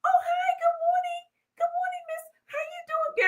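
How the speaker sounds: very high, soft sugary greeting; mock the phony sweet act; fake polite voice. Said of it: "But let them come back in".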